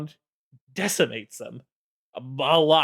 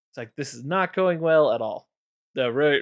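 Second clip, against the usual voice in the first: ordinary talk, nothing marked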